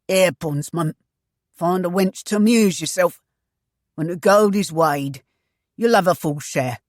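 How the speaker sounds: a pirate captain paying off a bondsman, loud and dismissive; gruff pirate captain. Acted. Pellier buys off Ruark, loud and scornful.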